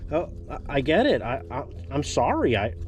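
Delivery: usual speaking voice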